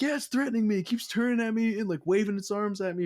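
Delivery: high, loud indignant retort; worked-up protest. Retold or imitated. Retelling the answer to Austin laughing, high indignant protest. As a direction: protest frantically, pitch way up. Acted indignant reply to teasing partner.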